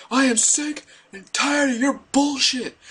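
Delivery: hammed-up kid losing it, dragging each word; freaking out. Seventh grader yelling at the bully who picks on him. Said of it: "I freak out, I'm like".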